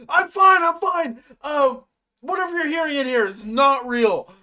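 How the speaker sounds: loud, high-pitched mock panic; frantic denial. Insisting to Ribbit that nothing is real, frantic denial. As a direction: imitate someone insisting far too hard.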